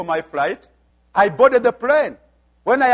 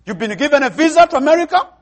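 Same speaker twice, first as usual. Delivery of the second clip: loud, high astonished question; mock incredulity; 4 semitones higher, 5 dB louder than usual. Friends hearing of the preacher's American visa, incredulous. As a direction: exclaim it in disbelief, rising at the end. Acting out disbelieving reaction to visa.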